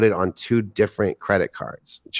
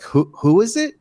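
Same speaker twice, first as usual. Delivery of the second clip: much higher, puzzled mock voice; a lightly mocked confused friend, pitched up; clueless friend; 10 semitones higher than usual. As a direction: play the friend who has no idea who that is. Friends on payphone don't know him.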